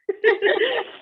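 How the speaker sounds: high teary laugh; tearful laugh. Real, not acted. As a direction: laugh through the tears. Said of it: "ugly cry".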